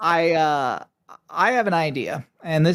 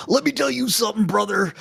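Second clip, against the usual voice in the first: raised gravel-free promo voice, a hint of Hulk Hogan; lightly hammed-up wrestler catchphrase; wrestling promo; 5 semitones higher than usual. Shown as a demonstration. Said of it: "Do you have a wrestling promo right now?".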